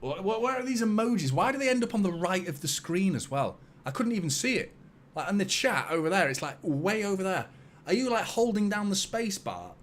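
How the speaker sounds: high-pitched incredulous questioning; baffled complaint. Real, not acted. Host asking a chatter about stray emojis, baffled.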